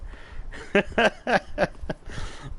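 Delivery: airy high-pitched giggle. Real, not acted. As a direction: giggle at your own joke. Laughing after embarrassing demo.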